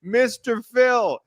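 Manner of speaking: hammed-up high pleading voice; mock pleading crew. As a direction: beg like a terrified child. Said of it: "they go crawling on their hands and knees".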